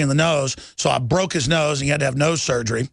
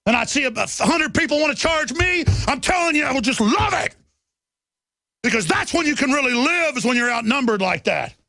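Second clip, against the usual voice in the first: worked-up boast; 9 semitones higher than usual. Real, not acted. Boasting of fighting crowds.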